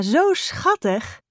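drawn-out tender cooing. A narrator's reading. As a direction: melt over the kitten. Mother melts over purring kitten.

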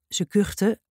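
plain reading voice

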